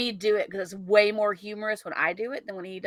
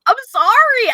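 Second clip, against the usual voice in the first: loud mock apology; 12 semitones higher, 9 dB louder, slower than usual. Real, not acted.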